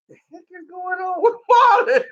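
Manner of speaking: baffled shout. Retold or imitated. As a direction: cry out in disbelief at Marlon. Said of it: "They were like".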